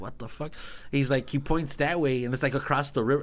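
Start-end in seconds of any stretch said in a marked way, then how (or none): 0.0-0.5 much softer baffled mutter; hushed retold swear. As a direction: mutter it like a confused stranger. Guy baffled by their question.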